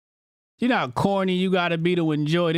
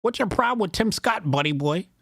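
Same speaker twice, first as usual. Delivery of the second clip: higher-pitched whining parody of a critic; mock an indignant viewer, pitched up, dropping on the last words; mock chat complainer; 4 semitones higher than usual. Host mimics chat defending senator, mock complainer. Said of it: "They be coming in chat".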